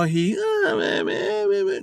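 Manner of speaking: high nasal-free "meh, meh" bleat of a whiner; mock whimper about his girlfriend; mock whining. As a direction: mimic him whining like a baby. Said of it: "he's whining and crying about it".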